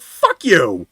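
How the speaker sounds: loud, explosive comeback; retold angry outburst. Driver firing back at the adjuster, furious. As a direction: hurl it out loudly.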